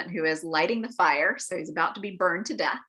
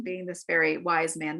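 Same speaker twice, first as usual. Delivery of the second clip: normal talking voice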